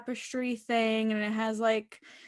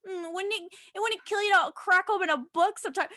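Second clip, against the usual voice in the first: higher, fast mocking voice; mocking Maeve's snooty jab, higher and fast; snide mock Maeve; 6 semitones higher, faster than usual. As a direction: mimic her snide line.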